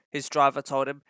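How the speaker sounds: ordinary delivery, nothing marked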